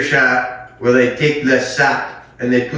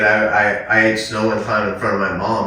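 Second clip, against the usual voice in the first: ordinary talk, nothing marked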